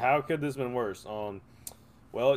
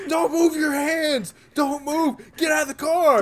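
high, loud barked orders; cop shouting; 12 semitones higher, 5 dB louder than usual. Retold or imitated. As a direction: yell contradictory commands. Cops yelling with guns drawn.

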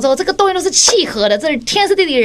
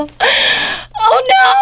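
higher, slow laughing oh no; higher slow giggling dismay; 10 semitones higher than usual. Retold or imitated. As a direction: laugh through the cringe. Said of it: "recalling the awkward kiss".

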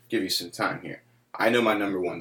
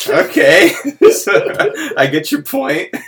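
laughing reply; 11 dB louder than usual. Retold or imitated.

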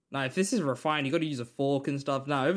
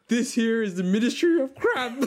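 mock announcer; 8 semitones higher, 5 dB louder than usual. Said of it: "It's like the".

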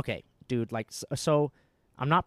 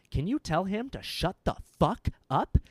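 higher, louder, slowed mock rudeness; rude demand; 6 semitones higher, 4 dB louder than usual. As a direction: voice her snapping at the family. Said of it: "she turns and she goes".

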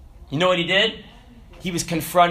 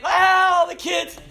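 mimicked eager kids; 13 semitones higher, 4 dB louder, much slower than usual. Kids reacting on career day, high mock excitement. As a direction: act out a roomful of excited hands.

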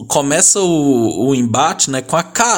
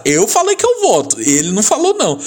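lightly voiced Sara, high at the start; a hint of a contestant protesting; mock Sara; 7 semitones higher than usual. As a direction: quote her in a jumped-up voice. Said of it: "aí a Sara".

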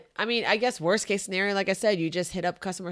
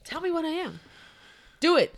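eager demand; 11 semitones higher, 3 dB louder than usual. Real, not acted.

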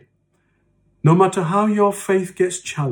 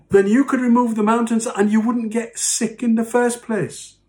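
higher, preachy mock accusation; mock super spiritual; 6 semitones higher than usual. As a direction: lecture them like a pious know-it-all. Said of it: "we go up to one another and said".

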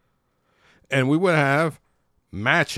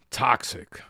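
whispered sneering "Toxic."; mock sneer. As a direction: breathe out "Toxic." with contempt. Mocking critics' buzzword.

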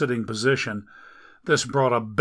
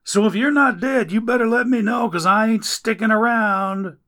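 higher, louder rough yell at a body on the floor; yelling stranger; 8 semitones higher, 5 dB louder than usual. Acted. Stranger finds Dagon in flooded mansion, yelling higher and louder. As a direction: holler to check if he's dead. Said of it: "a voice yelled".

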